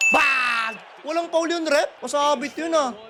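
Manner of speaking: high, loud reaction to the play; worked-up courtside protest; heated fan shout. Real, not acted. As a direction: yell it at the game.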